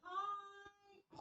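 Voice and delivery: high, pinched nasal hi. Retold or imitated. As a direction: push the hi through the nose. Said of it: "she said in a very nasally voice".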